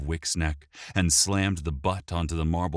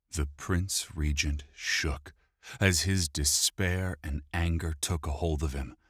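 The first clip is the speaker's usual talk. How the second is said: ordinary delivery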